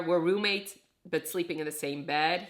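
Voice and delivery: ordinary talk, nothing marked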